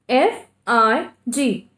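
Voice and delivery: F I G sounded out letter by letter, slowly; teacher sounding out the letters of a word. Shown as a demonstration. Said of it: "What is sound?".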